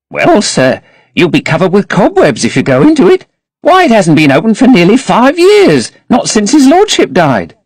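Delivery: put-on high, protesting old servant voice; an elderly housekeeper fretting over dust; fussy old housekeeper. A narrator's reading. Voices Mrs Leaf warning.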